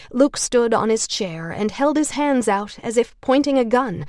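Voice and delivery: plain reading voice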